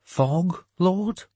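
high, rising question; questioning underling. A narrator's reading. Follower querying his lord about fog, questioning. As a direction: ask it up high, as a puzzled question.

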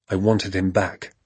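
usual speaking voice

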